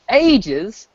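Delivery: a high British ages; mock British lift on ages; British accent. Savoring the British word ages in a British accent. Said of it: "I've learned the word ages".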